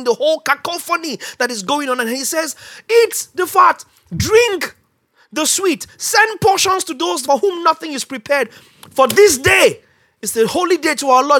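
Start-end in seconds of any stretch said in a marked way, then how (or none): 2.9-11.4 high loud proclamation; proclaiming. Retold or imitated. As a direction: call the people to feast. Nehemiah quoted proclaiming.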